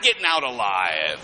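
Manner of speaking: ominous low. Retold or imitated. Voicing a witness, dark punchline.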